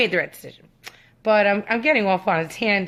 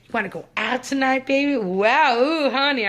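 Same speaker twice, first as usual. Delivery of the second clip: lightly hammed-up flirting, pitched up; mock admiring catcall; mimicked come-on; 5 semitones higher than usual. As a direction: impersonate a guy hitting on someone. Acts out his flirtatious come-on.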